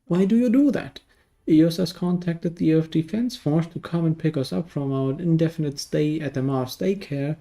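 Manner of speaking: normal talking voice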